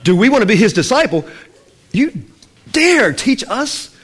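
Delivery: outraged religious leaders; indignant outrage. Acted. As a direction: spit out dare teach us in disbelief. Pharisees outraged at healed man.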